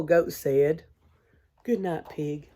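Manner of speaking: ordinary talk, nothing marked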